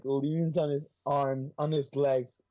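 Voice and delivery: ordinary talk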